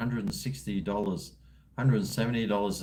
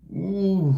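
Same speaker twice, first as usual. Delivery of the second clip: delighted ooh; 8 semitones higher, 5 dB louder than usual. Real, not acted. Remembering the burger.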